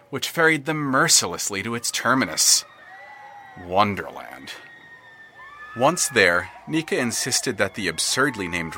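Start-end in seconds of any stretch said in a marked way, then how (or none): 3.7-4.5 Wonderland said much lower and drawn out, ominous. A narrator's reading. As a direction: let Wonderland sink low like a doom bell. Ominous destination named.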